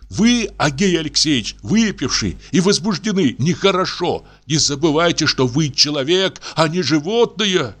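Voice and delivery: high, preachy reasoning tone; doctor moralizing. A narrator's reading. Doctor lecturing drunk investigator on decency, preachy. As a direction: lecture him like a pupil.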